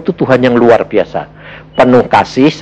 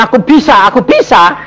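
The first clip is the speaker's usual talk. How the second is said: boastful; 10 semitones higher than usual. Retold or imitated. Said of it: "kamu merasa kamu".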